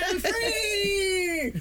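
high laughing shout of joy; ecstatic shout. Pretend. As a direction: scream with giddy relief. Parent escaping the kid.

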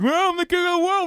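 high, loud mocking Cameron voice; James Cameron impression. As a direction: ham up the big Oscar speech. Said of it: "And he does, like".

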